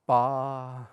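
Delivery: startled gasp into loud ba; wrong way, panic breath then hit ba. Shown as a demonstration. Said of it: "we ever wanna breathe and go".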